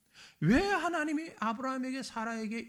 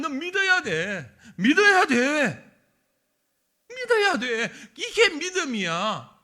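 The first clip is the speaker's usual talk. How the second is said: louder, laughing "믿어야 돼" repeated; urgent urging; 5 semitones higher, 9 dB louder, slower than usual. Acted. Preacher as God urging Abraham to believe, louder. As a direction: press the point with a chuckle.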